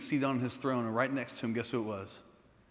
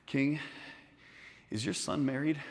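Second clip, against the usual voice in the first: hesitant, soft, halting request; timid, quiet asking; nervous; 7 dB quieter than usual. Acted. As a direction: work up the nerve with long pauses. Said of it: "I'm a little bit nervous".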